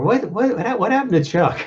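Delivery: high, puzzled dad voice; Mr Cunningham voice. Retold or imitated. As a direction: ask like a confused sitcom father. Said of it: "Like, he says it".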